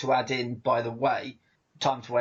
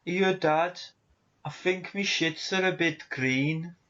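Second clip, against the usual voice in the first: higher-pitched, hammed-up Brummie child; attempted Brummie; 4 semitones higher than usual. Child reporting to Dad, badly faked Brummie. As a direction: put on a comic Brummie accent. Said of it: "I can't do accents. I tried".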